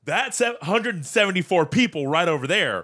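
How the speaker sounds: high, mock-urgent airline worker; frantic urging. Airline worker hurries late passengers through customs, mock frantic urging. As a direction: push them along, high and pressing. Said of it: "he's looking at us frantically".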